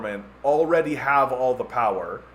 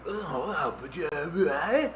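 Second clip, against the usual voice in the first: softer, awed little grunts; Joe Rogan impression; 5 dB quieter, much slower than usual. Lightly voiced Joe Rogan, awed by his guest. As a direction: react like a dazzled podcast host. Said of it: "Joe Rogan goes".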